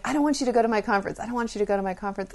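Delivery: rapid, high-pitched repeated pleading; insistent child. Retold or imitated. Daughter to mother before the conference, insistent. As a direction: rattle it off like a nagging kid.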